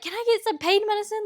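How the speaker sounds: high desperate plea; pleading. Retold or imitated. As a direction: beg for it in pain.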